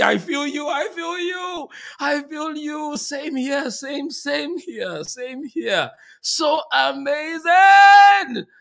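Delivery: high, fervent outpouring swelling to a shout; emotional, overwhelmed. Real, not acted. Host agreeing with an emotional viewer, overwhelmed. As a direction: repeat it fervently, high and moved. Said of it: "I'm emotional already".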